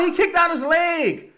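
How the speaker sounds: high furious yell; shouting. Real, not acted. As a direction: scream it at the ref. Shouted protest, then calmer repeat.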